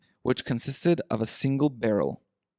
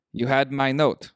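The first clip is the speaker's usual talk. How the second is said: a hint of a German accent, lightly harsh; understated German-accented count; mild German accent; 2 semitones higher than usual. A narrator's reading. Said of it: "with a deep, harsh voice and a strong German accent".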